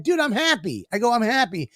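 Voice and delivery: mildly indignant, re-enacting his own protest; retold protest. Retelling his protest to his co-host.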